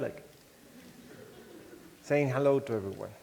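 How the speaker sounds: ordinary delivery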